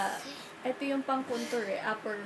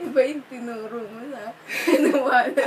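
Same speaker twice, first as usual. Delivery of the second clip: words broken by laughter; laughing; 7 dB louder than usual. Real, not acted. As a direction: laugh through the line. Laughing at wrong makeup pick.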